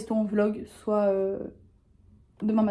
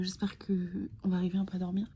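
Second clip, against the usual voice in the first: whispering; 8 dB quieter than usual. Real, not acted. Whispering at night before sleep.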